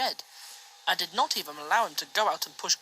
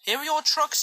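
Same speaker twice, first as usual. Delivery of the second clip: higher, louder teasing little engine; cheeky tank engine; 9 semitones higher, 6 dB louder than usual. A narrator's reading. Little tank engine taunting James, higher and louder. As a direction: tease him with a bright jeer.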